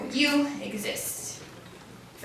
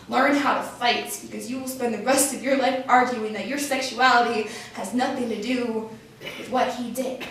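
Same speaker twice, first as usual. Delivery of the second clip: usual speaking voice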